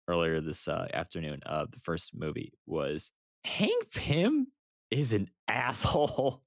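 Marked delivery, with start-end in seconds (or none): none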